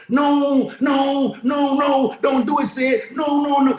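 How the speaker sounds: yelped no no no in a comic high voice; Kevin Hart voice. Retold or imitated. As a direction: beg in a squealing Kevin Hart panic. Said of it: "my Kevin, Kevin Hart voice".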